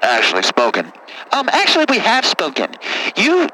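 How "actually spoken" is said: put-on much lower, rough-talking good ol' boy; deep caller voice. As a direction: play a low-voiced country caller. Same caller voice.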